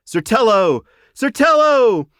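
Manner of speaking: high, loud joyful calls; friends calling. A narrator's reading. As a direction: call out to him across the crowd. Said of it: "the sound of the voices of his friends".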